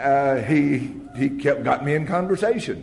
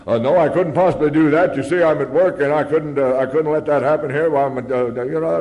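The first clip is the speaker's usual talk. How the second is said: loud, higher-pitched flustered excuses; backing off; 5 semitones higher, 8 dB louder than usual. Retold or imitated. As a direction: back out hastily, stumbling over excuses. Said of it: "it was time to back off".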